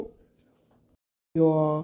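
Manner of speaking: long, low drawn-out crying wail; hammed-up sobbing ayyo; comic wail. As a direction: wail it out like a heartbroken man. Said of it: "ನೀನ್ ಅಳ್ಬೇಡ ಇರು ರಾಮಕೃಷ್ಣ".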